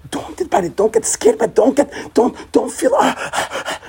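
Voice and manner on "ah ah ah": breathy, high mock panting; panicky gasps. Teacher showing how not to feel before mountains, mock panic. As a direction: gasp as if overwhelmed by fear. Imitating being scared by the mountain.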